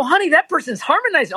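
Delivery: high insistent explaining; emphatic. Retold or imitated. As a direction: explain it eagerly to honey. Arguing with wife, high.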